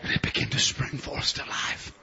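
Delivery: soft, low, breathy-quiet seed; hushed, whispering seed. Acted. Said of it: "their little seed said".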